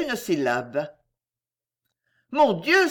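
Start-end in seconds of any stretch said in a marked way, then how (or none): 2.3-2.9 startled exclamation. A narrator's reading. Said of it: "s'écria Clarence".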